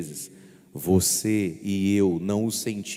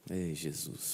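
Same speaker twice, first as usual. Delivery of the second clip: rueful sigh; 4 semitones lower, 11 dB quieter, slower than usual. Shown as a demonstration. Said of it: "Faz, faz, faz".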